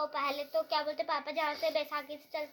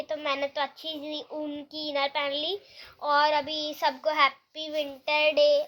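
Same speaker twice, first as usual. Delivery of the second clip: usual speaking voice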